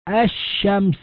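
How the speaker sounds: الشمس said "ash-shams", the lam merged into a doubled shin; lam assimilation demo. Shown as a demonstration. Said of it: "فمثلًا نقول". (الشمس بإدغام اللام في الشين)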